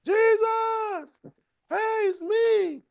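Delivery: high, eager mock yell of a name; high, drawn-out hailing call, slow at the end. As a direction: wave someone down in a crowd. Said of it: "started yelling".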